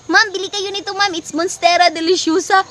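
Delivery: high, mock pushy seller pitch; imitating the plant seller. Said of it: "sabi niya".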